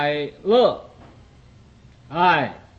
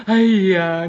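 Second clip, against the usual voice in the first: loud mock wail of grief; wailing cry; 7 dB louder than usual. Crying at someone's wedding, loud mock wail. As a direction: burst out lamenting, hammed up. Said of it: "你到那地儿就哭起来了".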